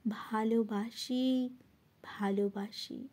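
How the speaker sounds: tender reply. Acted. Tender answer in the poem's refrain. (কোমল, নিচু স্বরে)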